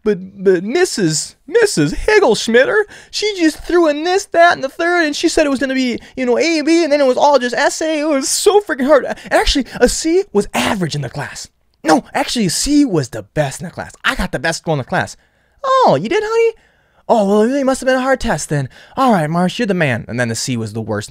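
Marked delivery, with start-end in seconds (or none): 0.0-15.2 put-on much higher, pleading kid voice, slowed on the teacher's name; whiny kid excuses. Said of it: "I'm like". 15.6-20.0 much higher, syrupy mother voice; sweet mom. Retold or imitated. Mom softening after the lie.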